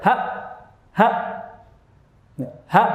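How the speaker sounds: "ha" chopped abruptly short; short, separate "ha" with no flow; cut-off sound. Shown as a demonstration. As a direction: stop the air dead after each "ha". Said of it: "sifatnya syiddah, diputus aliran suaranya".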